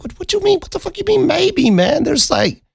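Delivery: very high, squeaky protest; incredulous. Retold or imitated. Teller re-enacts balking at her answer, incredulous.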